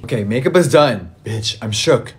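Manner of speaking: ordinary talk, nothing marked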